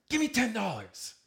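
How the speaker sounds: full-force high-pitched shout; screaming and yelling. Acted. Thief two feet away demanding cash, screaming. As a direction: scream the demand at the top of your lungs. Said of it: "he's screaming and yelling".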